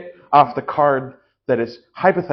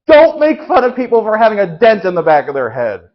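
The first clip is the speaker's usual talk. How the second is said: angry preacher; 7 semitones higher, 7 dB louder than usual. Acted. Angry preacher scolding the congregation.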